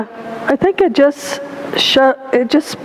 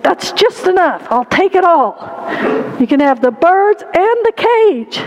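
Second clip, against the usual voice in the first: put-on high-pitched little boy clinching a deal; pleased child bargainer, higher voice; 6 semitones higher than usual. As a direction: announce it eagerly, pitched up like a boy. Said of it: "and the little boy said".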